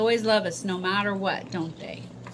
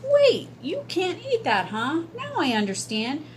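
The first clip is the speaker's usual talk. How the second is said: put-on young boy voice; 7 semitones higher than usual. Boy's voice in story.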